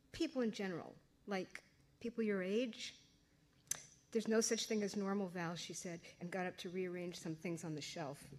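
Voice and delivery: reading her novel aloud to an audience; story narration. A narrator's reading. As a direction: tell it like a teenage diary.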